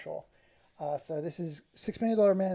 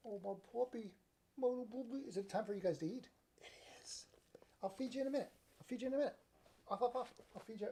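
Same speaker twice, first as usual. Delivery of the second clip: softer and higher, cooing at the puppies; talking to his puppies at feeding time, soft and higher; puppy talk; 6 semitones higher, 9 dB quieter, faster than usual. Real, not acted. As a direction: coo to your little dogs.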